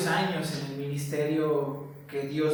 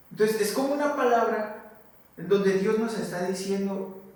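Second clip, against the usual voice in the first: usual speaking voice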